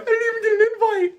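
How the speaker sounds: high, fast mocking whine; whiny excuse voice. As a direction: parody a sulky excuse-maker. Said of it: "They're like".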